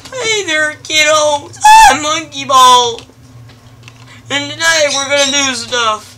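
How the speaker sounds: very high, loud cartoon host voice; kids' show host. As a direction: squeal it like a hyper kids' TV monkey.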